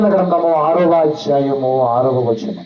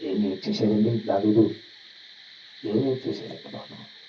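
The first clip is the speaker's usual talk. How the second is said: hushed question; 9 semitones lower, 10 dB quieter, slower than usual. Real, not acted. Preacher drops to hushed question.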